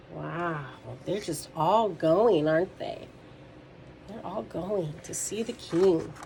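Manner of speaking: usual speaking voice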